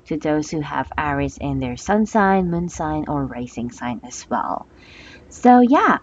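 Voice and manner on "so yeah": excited. Real, not acted. Restart with excited emphasis.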